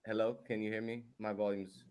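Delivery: muffled, distant voice. Real, not acted. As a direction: speak through a covered mic. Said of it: "It's muffled".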